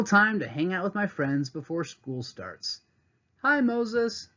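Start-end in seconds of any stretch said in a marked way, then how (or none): 3.4-4.3 a lightly voiced girl, higher; friendly greeting. A narrator's reading. Classmate Kayla greeting Moses, lightly voiced girl. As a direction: call out a bright hello. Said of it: "says Kayla".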